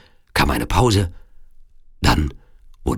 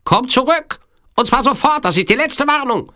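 very high, loud villain's ultimatum; commanding Sicilian villain; 4 dB louder than usual. Acted. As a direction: order her back in a piercingly high voice. Villain orders princess back, final warning. (hohe, laute Drohung des Sizilianers)

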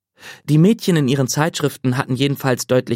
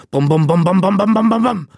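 heartbeat thuds building higher and louder; pounding heartbeat; 6 semitones higher, 4 dB louder than usual. A narrator's reading. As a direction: let the pounding climb to a peak. Heartbeat rising in the chest.

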